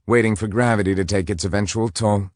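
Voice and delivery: usual speaking voice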